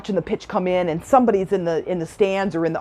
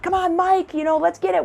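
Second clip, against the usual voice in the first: high cheering call without full volume; imitated sideline yell; 11 semitones higher than usual. Cheering on the kids up to bat, imitated high yell. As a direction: urge the batter on in a high voice. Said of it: "you're yelling the, you know, the boys' name".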